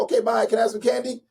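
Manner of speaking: put-on high, hurried kid voice brushing off her dad; lightly voiced young daughter; little daughter voice. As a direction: play the distracted little girl asking for candy. Imitating his young daughter.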